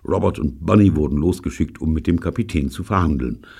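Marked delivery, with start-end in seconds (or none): none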